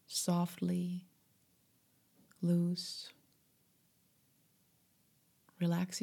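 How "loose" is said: ordinary delivery, nothing marked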